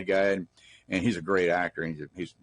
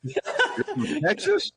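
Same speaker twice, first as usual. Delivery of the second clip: high-pitched, drawn-out shocked question; mock-horrified squeal of disbelief; scared disbelief; much slower than usual. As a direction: imitate stunned actors, voice shooting way up. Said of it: "this scared look on their face".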